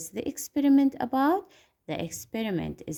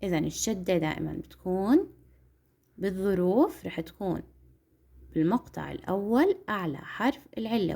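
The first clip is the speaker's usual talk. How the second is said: ordinary delivery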